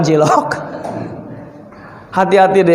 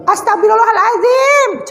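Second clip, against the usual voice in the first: shocked gasp of a word; 13 semitones higher than usual. Acted. Shocked at face in mirror.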